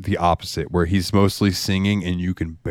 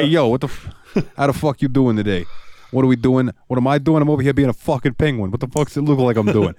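higher, indignant New York rant; hammed-up New York penguin; thick New York accent; 5 semitones higher than usual. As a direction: gripe like a ticked-off New Yorker. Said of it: "a thick New York accent".

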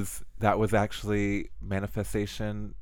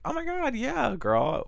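gushing fan; 14 semitones higher, slower than usual. Retold or imitated. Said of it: "be like".

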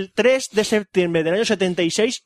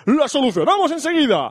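chirpy support voice; 9 semitones higher than usual. Retold or imitated. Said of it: "dijeron por Twitter".

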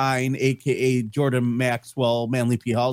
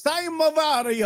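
a much higher, cartoonish Mario impression. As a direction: squeak it out like the video game plumber. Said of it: "I always want to make Mario Kart jokes".

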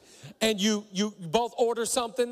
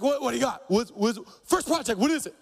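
intense bark; 5 semitones higher than usual. Retold or imitated. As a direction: fire the questions like a linebacker ready to go. Heath demanding next project.

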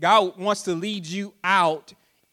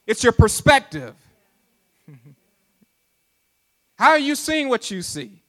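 rising, high, ever louder preacher cries; higher and louder, climbing to a peak; preaching build-up; 4 semitones higher, 5 dB louder than usual. Acted. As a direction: build the wonder to a shout. Preaching at raised pitch.

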